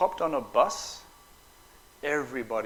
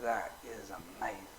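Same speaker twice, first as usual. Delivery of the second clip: soft, low, amazed murmur; hushed awe; 3 semitones lower, 10 dB quieter than usual. Retold or imitated. Sitting on the roof during the attack, hushed awe. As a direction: murmur it like you can't look away.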